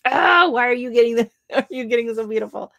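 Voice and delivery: hammed-up jealous outburst, high and loud at first; mock jealous. Streamer telling viewers about a friend's flower pictures, mock jealous. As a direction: exclaim in playful envy with a laugh midway. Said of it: "I was so jealous. I'm like".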